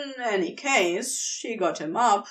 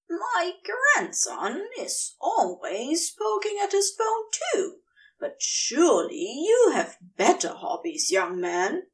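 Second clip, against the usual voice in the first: put-on gently chiding elderly lady, higher; old lady voice; 7 semitones higher than usual. Gladys scolds gently.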